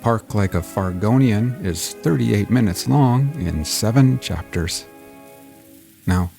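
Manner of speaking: wry, animated storyteller teasing dark events; theatrical host introduction. Acted. Host warns sensitive listeners before the show, theatrical introduction.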